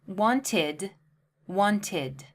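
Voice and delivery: wanted with the id ending, two syllables; wanted, clearly two syllables; repeat wanted. Shown as a demonstration. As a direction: say wanted slowly for listening. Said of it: "Let's listen again".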